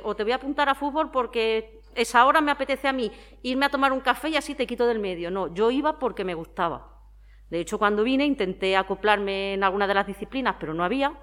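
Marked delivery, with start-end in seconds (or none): 0.2-5.3 higher-pitched quoted parent voice; imitating self-serving parents. Parent wanting coffee signs child up for football, mimicked excuse-making. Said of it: "que te dicen".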